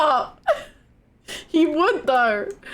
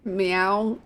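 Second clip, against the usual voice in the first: low kitten meow imitation; 4 semitones lower than usual. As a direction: meow to win the cat over. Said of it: "meow like a kitten".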